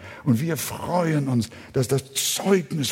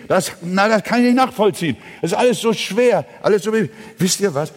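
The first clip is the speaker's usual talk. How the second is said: high, rapid run of objections; fervent preaching; 7 semitones higher, 4 dB louder, much faster than usual. Real, not acted. Preacher voices listeners finding it too hard, fervent and fast. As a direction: rattle off listeners' complaints in a raised preaching voice.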